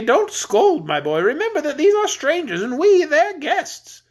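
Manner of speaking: high, jolly voice of a stout king; fat jolly king. Acted.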